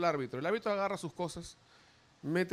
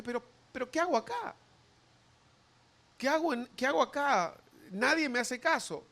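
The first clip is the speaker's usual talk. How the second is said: high, puzzled lament; high bewildered lament of being ignored; bewildered voice of God; 8 semitones higher than usual. Acted. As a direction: ask it in disbelief, high.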